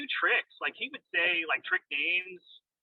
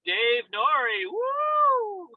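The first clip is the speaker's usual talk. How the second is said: drawn-out announcer name call, high woo; Sean Wilkerson; 13 semitones higher, much slower than usual. Retold or imitated. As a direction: call each name slowly, then whoop high. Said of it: "his style was like".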